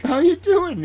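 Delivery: put-on high sing-song greeting to a baby; baby talk. Greeting the toddler.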